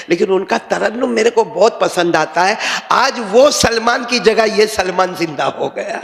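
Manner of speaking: usual speaking voice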